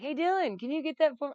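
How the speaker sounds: bright high call to Dylan; asking Dylan to grab the poster, called out high; eager call. Retold or imitated. Calling out to Dylan.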